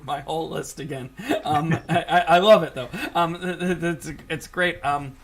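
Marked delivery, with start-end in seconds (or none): 1.4-3.9 laughing. Real, not acted. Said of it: "laughing while speaking".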